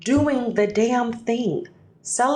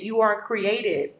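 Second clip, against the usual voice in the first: normal talking voice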